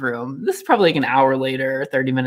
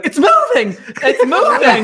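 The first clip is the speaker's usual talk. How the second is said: high, loud mock panic; imitating panic; 9 semitones higher, 6 dB louder than usual. Imitating Dwayne panicking at the rubber snake. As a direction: squeal it high as if terrified. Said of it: "Dwayne's going".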